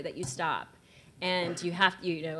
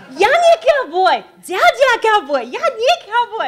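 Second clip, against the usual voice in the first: much higher, louder, slow, laughing; mocking her own eager boast to horsemen; mock-proud reply; 14 dB louder, slower than usual. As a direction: boast in broken English.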